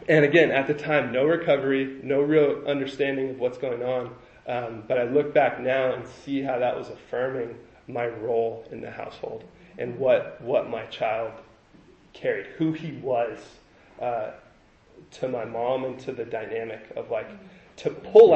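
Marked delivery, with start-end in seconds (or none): none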